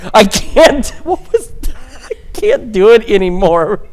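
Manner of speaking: breaking into helpless laughter mid-sentence; can't get the words out for laughing. Real, not acted. Weatherman cracking up on live morning news, laughing.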